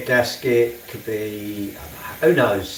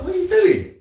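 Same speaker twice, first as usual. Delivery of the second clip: much higher, louder exasperated "what are you doing"; incredulous mock outburst; 5 dB louder than usual. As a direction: fling it out in mock disbelief. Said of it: "I'm like".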